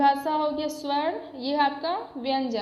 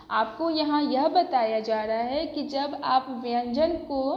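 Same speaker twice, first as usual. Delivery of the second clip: ordinary talk, nothing marked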